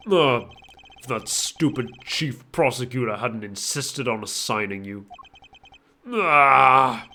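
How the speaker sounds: deep, flustered, panicky villain. Acted. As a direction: lose confidence in a deep voice. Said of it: "less confident".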